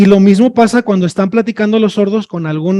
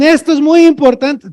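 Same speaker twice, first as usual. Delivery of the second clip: higher, mock shouting at a deaf listener; shouted emphasis; 7 semitones higher than usual. Signer yelling to stress importance, mock shout. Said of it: "entonces te gritan".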